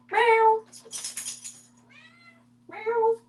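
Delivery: high cat meow. Pretend. Meowing at the cat.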